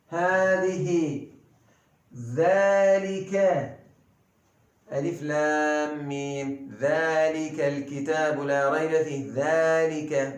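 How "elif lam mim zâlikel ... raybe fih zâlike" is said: usual speaking voice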